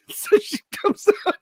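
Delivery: words squeezed out through laughter; can't stop laughing while saying it; giggling breathlessly. Real, not acted.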